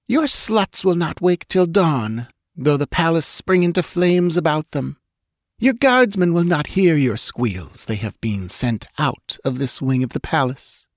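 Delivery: high, unhurried cruel taunt; callous. A narrator's reading. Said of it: "callously answered the stranger".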